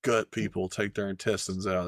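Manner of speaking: ordinary delivery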